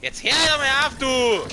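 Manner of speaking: high, loud fed-up yell; frustrated shout. Real, not acted. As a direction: snap at the enemy loudly. Yelling at enemies. (genervter Schrei)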